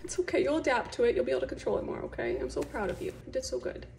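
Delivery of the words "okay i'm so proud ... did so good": ordinary delivery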